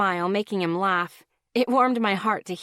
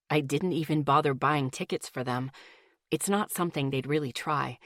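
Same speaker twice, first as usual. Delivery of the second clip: a hint of a calm young man, lower; relaxed boyfriend voice, a touch lower; lightly lower Miles voice; 6 semitones lower, 4 dB quieter than usual. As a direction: voice Miles a little lower and easy.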